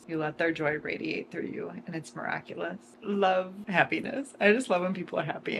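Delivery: ordinary delivery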